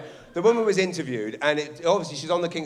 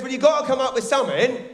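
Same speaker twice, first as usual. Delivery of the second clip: mock exasperated editor; 8 semitones higher, 5 dB louder than usual. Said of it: "the editor says".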